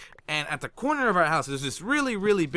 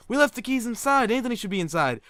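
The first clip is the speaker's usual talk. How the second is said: mimicked parents; 8 semitones higher than usual. Voices parents answering him.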